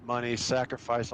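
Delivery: usual speaking voice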